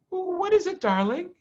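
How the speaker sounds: high, quick hopeful question; Missus Fox eager. Acted. Mrs Fox asks her husband quickly, high. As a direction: ask quickly, hoping. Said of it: "said Missus Fox quickly".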